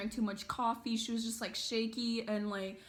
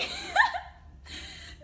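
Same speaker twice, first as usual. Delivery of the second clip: shrieking laugh; full laughing fit; loud high burst of laughter; 4 dB louder than usual. Real, not acted. Peak of laughter.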